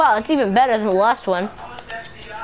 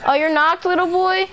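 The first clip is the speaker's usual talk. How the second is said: mocking taunt; 8 semitones higher than usual. Real, not acted. Taunting a downed enemy.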